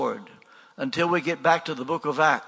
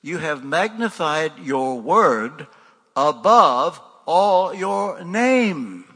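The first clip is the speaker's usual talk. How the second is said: slow, weighty reading that swells louder; slow solemn scripture reading, rising to a louder finish; solemn scripture quote; 5 dB louder, much slower than usual. Retold or imitated. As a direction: read the verse slowly and build. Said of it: "where the Hebrew says".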